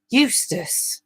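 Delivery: a touch of shocked reproof; appalled, aghast. A narrator's reading. Wife chides Eustace by name, mildly appalled. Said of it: "in an appalled and aghast voice".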